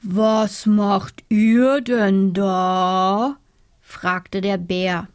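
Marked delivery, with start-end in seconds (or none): 0.0-3.4 slow, heavy bear asking; a big sleepy bear drawls. Acted. Bear asks the hanging animals, slow and heavy.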